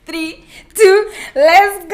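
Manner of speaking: loud excited countdown; excited shout. Real, not acted. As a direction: hype countdown yell. Hyping the guest intro.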